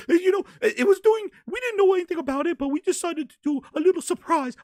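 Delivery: very high, giggly cartoon falsetto; high-pitched mascot chirping with "oh" giggles; Mickey Mouse voice. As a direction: squeal it like a flustered cartoon mouse. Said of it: "[name] is the goddamn Mickey Mouse".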